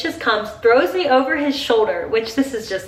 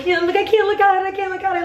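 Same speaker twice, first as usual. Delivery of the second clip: nervous fiancé; 10 semitones higher, faster than usual. Retold or imitated. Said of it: "He's like".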